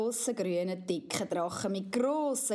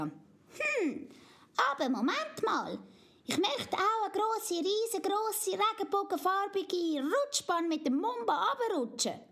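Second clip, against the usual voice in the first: put-on high, eager little girl voice; little girl Amalia; 10 semitones higher than usual. Amalia warns Mumba not to wake Papi, high child voice. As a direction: chatter like a bossy little princess.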